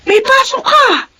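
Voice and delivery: sharp, slow, high scolding shout. Acted. Mother scolds son who won't eat. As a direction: snap at the child to eat first.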